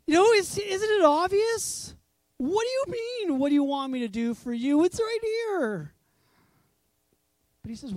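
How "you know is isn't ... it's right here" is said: high, loud exasperated disbelief; incredulous protest. Acted. Onlookers baffled by Jesus' question, incredulous. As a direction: protest as if it's glaringly obvious.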